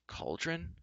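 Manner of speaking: soft, high, rising question; hushed, rising query; murmur. A narrator's reading. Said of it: "murmured Gwistel".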